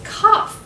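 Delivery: "cough" ending in off, pitched high; off sound. Shown as a demonstration. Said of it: "with the off sound".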